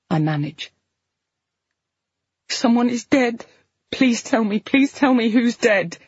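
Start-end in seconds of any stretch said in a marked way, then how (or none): 2.5-6.0 higher, pleading through tears; crying patient pleads with the nurse, voice raised; tearful pleading. Acted. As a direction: beg the nurse for an answer.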